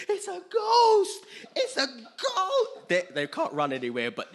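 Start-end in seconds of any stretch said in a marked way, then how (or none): 0.1-2.9 very high, loud, drawn-out panicked scream; hammed-up shriek of terror; terrified disciples. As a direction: scream it out like frightened men in a boat. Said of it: "they're shook and they're troubled".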